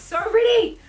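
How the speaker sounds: high-pitched eager squeal; hyped up. Real, not acted. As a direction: squeal with readiness. Hyping viewers for UV looks.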